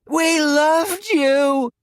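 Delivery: high, very slow wailing chorus; crying as one. Acted. The burning women crying to Quinn as one, a high, slow wail. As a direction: moan it like dying ghosts. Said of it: "they cried as one".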